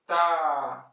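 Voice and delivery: "Tá" held long and loud; prolonged tá. Shown as a demonstration. Teacher showing a long sustained "Tá". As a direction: stretch "Tá" across the whole beat. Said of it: "Um tá prolongado".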